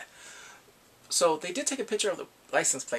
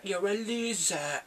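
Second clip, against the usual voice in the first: Big Bang style loser; 4 semitones higher, slower than usual. Retold or imitated. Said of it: "the way she said it was from, like, Big Bang".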